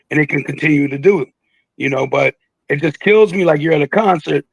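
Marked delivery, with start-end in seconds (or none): none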